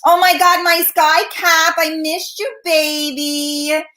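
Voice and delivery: high gushing hello, drawn out at the end; excited greeting. Real, not acted. Host spotting a viewer she missed, excited greeting.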